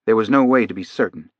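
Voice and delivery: usual speaking voice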